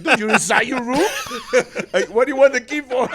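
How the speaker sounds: high, loud incredulous retelling through laughter; laughing disbelief. Retold or imitated. Retelling his reply to a drunk guest, incredulous and laughing. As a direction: crack up as you question the absurdity.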